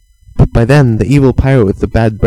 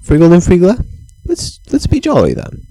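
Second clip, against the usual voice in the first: eager little people; 7 semitones higher than usual. Acted. Friglers decide to be jolly.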